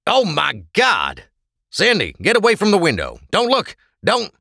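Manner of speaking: horrified, high loud cry; urgent warning. A narrator's reading. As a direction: shout an alarmed warning, pitch leaping up. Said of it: "Chuck warned too late".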